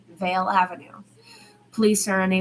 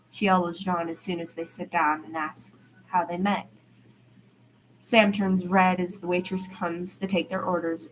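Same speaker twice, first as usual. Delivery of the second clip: plain reading voice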